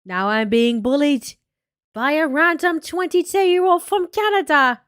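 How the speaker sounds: hammed-up, badly faked British accent, stretching the words. Streamer boasting as the vlogger, in a bad British accent. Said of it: "if I try to do the British accent".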